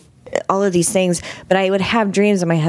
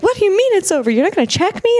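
much higher, panicked disbelief; shrill alarm at being let go unchecked; freaking out; faster than usual. Retold or imitated. To doctors after cancer is over, high panicked disbelief. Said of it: "I'm freaking out and afraid".

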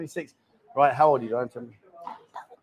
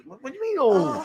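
mock indignant; 8 semitones higher than usual. Mock offended, pitch jumps.

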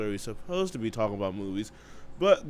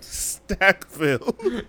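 mocking drawl; 8 semitones higher, 5 dB louder, much slower than usual. Mocking the name.

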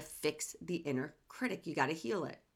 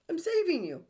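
mock overbearing insistence; insistent critic; 10 semitones higher than usual. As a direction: push it on them like a controlling parent. Voicing the inner critic.

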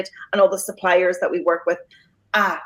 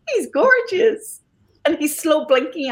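adoring coo; 7 semitones higher than usual. Real, not acted. Adoring the cat on camera.

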